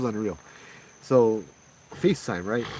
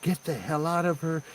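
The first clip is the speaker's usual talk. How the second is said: a friend yelling to drive the spirit out; angry yelling; 7 semitones higher than usual. Retold or imitated. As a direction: shout her down, furious. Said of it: "She started yelling".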